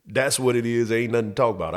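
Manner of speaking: ordinary delivery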